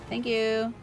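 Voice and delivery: normal talking voice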